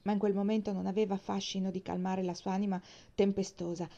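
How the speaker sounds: usual speaking voice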